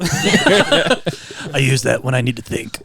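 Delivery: slow, gruff mock Batman line. Friend voicing Batman about the pool table, slow gruff. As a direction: drawl it like a brooding Batman.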